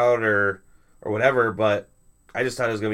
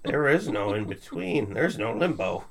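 slow, drawn-out wise-sage voice; Phoenix voice. Acted. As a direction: intone it like a grand oracle. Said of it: "that was my Phoenix voice".

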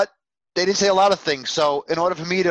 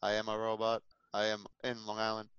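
flat monotone robot voice; 6 semitones lower, 13 dB quieter than usual. Pretend. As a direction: speak like a robot. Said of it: "trying to do my robot voice".

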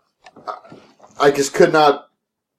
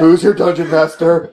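slow gloating taunt; mock dominant; 3 dB louder, slower than usual. Friend joining the whipping joke, slow gloat. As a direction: drag it out like a triumphant master.

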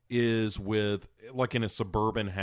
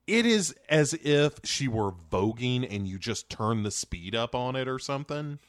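normal talking voice

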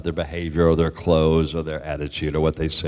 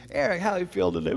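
lightly voiced kind doctor, raised pitch; doctor's question; 10 semitones higher than usual. The doctor asks Eric how he feels, lightly voiced. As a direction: ask gently like a caring doctor. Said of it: "and he says".

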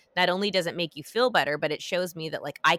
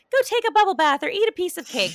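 high, loud, sing-out mock advice; mimicked perky advice; 14 semitones higher, 7 dB louder than usual. Mimicking what people mean by self-care, playfully perky and high. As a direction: parrot the advice in a much higher, louder voice.

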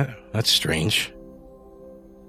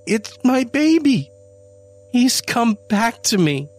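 much higher, louder, slowing frantic plea; desperate pleading; 13 semitones higher, 4 dB louder than usual. Acted. Janie pleading with Adam, high desperate voice. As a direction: plead to be believed. Janie insisting cat is son.

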